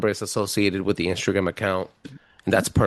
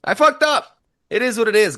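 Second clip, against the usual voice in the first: exclaimed; 12 semitones higher, 4 dB louder than usual. Real, not acted. Confessing loudly.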